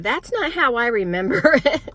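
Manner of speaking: loud, high, laughing protest. Retold or imitated. Arguing with mom about the closet, laughing loudly. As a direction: burst out laughing as she objects. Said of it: "And I'm like".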